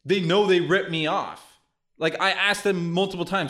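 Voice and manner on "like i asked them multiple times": ordinary delivery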